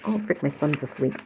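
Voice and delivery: usual speaking voice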